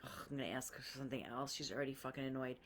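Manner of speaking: low, soft, fast grumble under the breath; muttered to herself. Retold or imitated. At the coffee counter, grumbling to herself, soft and fast.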